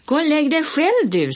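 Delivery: high, loud cheeky yell back; boy yelling back across the yard; shouted retort. A narrator's reading. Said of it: "skrek Olle".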